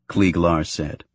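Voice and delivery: plain reading voice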